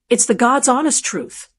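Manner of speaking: ordinary delivery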